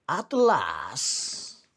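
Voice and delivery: usual speaking voice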